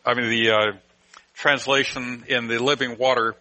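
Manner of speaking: normal talking voice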